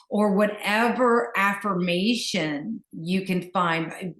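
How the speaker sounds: ordinary talk, nothing marked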